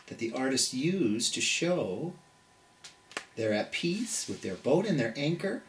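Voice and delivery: soft, hushed storybook reading; soothing picture-book voice; reading to children. Acted. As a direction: read gently, low and calm. Reading a calm book aloud.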